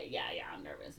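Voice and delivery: hushed, nervous whisper; boy confessing his nerves, whispered. Acted. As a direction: breathe it out.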